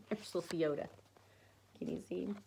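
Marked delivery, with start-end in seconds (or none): none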